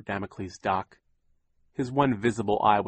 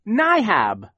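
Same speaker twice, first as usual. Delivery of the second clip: put-on high, loud baby shriek; 12 semitones higher, 9 dB louder than usual. A narrator's reading. Sonny shrieking at Captain Sham. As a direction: shriek in protest. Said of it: "Sonny shrieked".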